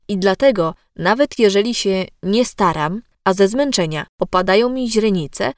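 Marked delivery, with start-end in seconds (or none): none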